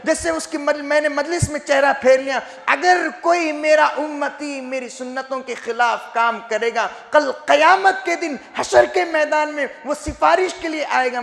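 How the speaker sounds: high, insistent sermon warning; impassioned preaching. Retold or imitated. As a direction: quote the warning with a preacher's fervour.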